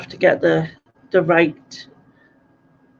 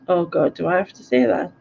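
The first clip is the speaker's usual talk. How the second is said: ordinary talk, nothing marked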